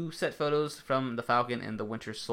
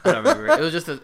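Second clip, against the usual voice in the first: loud, high words pushed through laughter; real laughing fit; 5 semitones higher, 14 dB louder, faster than usual. Real, not acted. Answering co-host about his old pick, cracking up. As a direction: answer while still cracking up. Still laughing over the casting joke.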